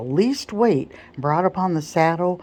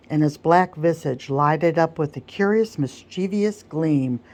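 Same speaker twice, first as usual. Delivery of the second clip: usual speaking voice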